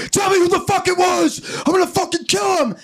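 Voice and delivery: high furious yell as Joseph; hammed-up jealous husband shouting; angry shout. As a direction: roar the threat, way up high. Joseph confronting Mary, acted.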